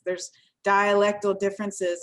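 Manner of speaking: ordinary talk, nothing marked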